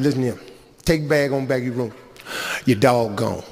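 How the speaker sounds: a hint of gruff bluntness; low blunt telling-off. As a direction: lay it on her, low and deadpan. Teller tells her off, low.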